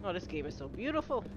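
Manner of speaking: ordinary delivery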